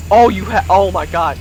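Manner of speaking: ordinary talk, nothing marked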